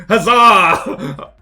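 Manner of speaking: loud, high mock-heroic cry; spring the surprise with a big shout; prankster's jubilant yell; triumphant huzzah. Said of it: "and I'd be like".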